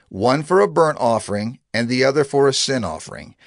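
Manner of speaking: usual speaking voice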